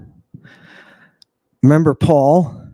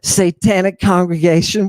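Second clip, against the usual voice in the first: normal talking voice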